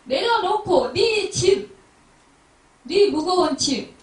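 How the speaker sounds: slow, loud pleading sermon; slow urgent preaching. Acted. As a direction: lean on every word, slowly. (느리고 힘주어 외치는 설교)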